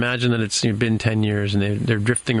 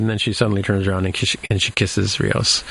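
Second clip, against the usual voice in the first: normal talking voice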